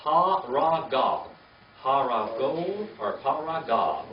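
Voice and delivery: Ha-ra-gav said slowly, each syllable separate; slow syllable model. Shown as a demonstration. Teacher to Bible class, slow syllable-by-syllable model. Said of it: "Say that one".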